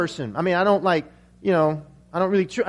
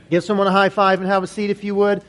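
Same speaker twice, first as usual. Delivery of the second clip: usual speaking voice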